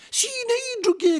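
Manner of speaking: very high female puppet voice, excited; excited high puppet cry; Rosaura crying out. A narrator's reading. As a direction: squeal the confirmation in falsetto. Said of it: "gridò la signora Rosaura".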